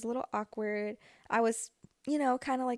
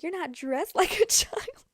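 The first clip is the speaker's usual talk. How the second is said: quoting mom through laughter, higher and slow; laughing while quoting mom; 6 semitones higher, 4 dB louder, slower than usual. Retold or imitated. Retelling mom's comeback to the girl, laughing. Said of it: "my mom was like".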